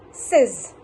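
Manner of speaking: says with a short e, as 'sez'; correct says. Shown as a demonstration. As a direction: model says slowly as one short-vowel 'sez'. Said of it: "exactly how to pronounce that word".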